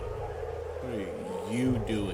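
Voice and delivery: What are you doing dragged out slow; drawn-out mock question. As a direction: stretch every word slowly and teasingly.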